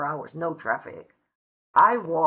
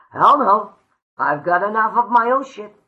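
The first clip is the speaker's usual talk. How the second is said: loud, high scoff at the idea; loud retold refusal; 7 semitones higher, 11 dB louder than usual. Telling her sponsor she'll never sponsor anyone, loud refusal. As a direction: bark it out like it's absurd.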